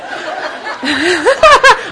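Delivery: a hearty burst of laughter at her own joke; loud laugh. Real, not acted.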